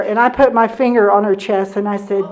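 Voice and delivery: usual speaking voice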